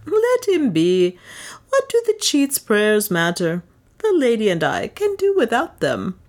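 high, slightly urgent protest; anxious dismissal. A narrator's reading. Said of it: "anxious to find an escape".

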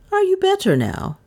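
very high-pitched enquiry; concerned question. A narrator's reading. Mistress asks after the dazed stranger, enquiring. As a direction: ask it high, falling at the end.

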